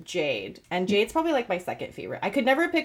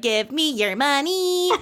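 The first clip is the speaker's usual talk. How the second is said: lightly voiced bratty toddler, higher and slow; 6 semitones higher, slower than usual. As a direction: coo it like a spoiled toddler, slowly.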